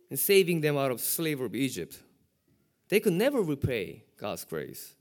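ordinary delivery

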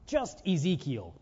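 ordinary delivery

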